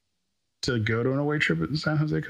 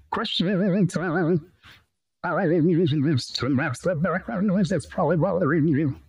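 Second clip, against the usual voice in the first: much higher, warbling processed voice; voice changer; 7 semitones higher than usual. Real, not acted. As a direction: speak through a wobbly high voice changer. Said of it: "I'll put on the voice changer".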